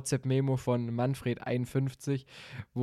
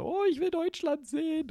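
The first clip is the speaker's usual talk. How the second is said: put-on high childish whine; whiny kid voice; 3 dB quieter than usual. Kid voiced in family scene.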